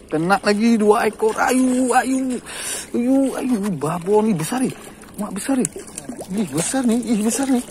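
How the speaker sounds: high-pitched thrill at the catch; excited. Real, not acted. Angler seeing two big fish hooked, excited.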